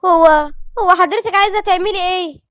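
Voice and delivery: high, loud, halting question; nervous Lilian. A narrator's reading. Daughter recoils from a mother she forgot, nervously asking. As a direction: stumble anxiously into the question, pitch up.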